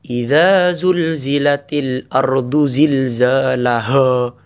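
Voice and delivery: slow, forceful chant; hard recitation. Shown as a demonstration. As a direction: let the earthquake verse sound stern. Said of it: "Keras itu". (tilawah keras dan tegas)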